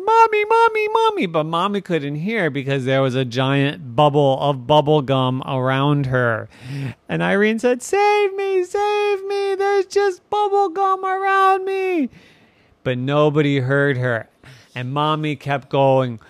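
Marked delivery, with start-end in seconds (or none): 0.0-1.3 very high, loud repeated call; panicked calling child. Acted. Irene trapped in the bubble calling Mommy, panicked. 7.8-12.1 very high squealed plea for help; panicked child. Acted. Irene trapped inside bubble gum begging rescue, panicked.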